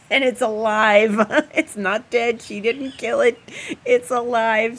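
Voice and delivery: laughing through the words. Real, not acted. Laughing as she tells a friend the rat is alive. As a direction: giggle while telling it.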